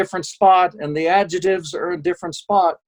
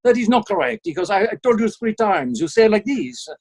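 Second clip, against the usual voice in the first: higher-pitched, exasperated mock Frenchman; pissy French accent; 4 semitones higher than usual. French caller correcting the voice actor, mock-pissy French accent. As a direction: scold in a put-on French accent. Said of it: "getting really pissy. ... guy was French".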